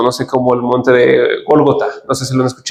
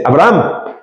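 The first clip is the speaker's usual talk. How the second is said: strong call; slower than usual. Shown as a demonstration. Teacher showing God calling Abraham strongly, a firm call. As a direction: call "Abraham" with weight, like an exclamation. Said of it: "Dios le habla fuerte".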